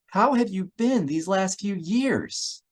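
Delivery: put-on an overjoyed old acquaintance; gushing Elise. A narrator's reading. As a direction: gush with delighted recognition. Elise hugging Hal.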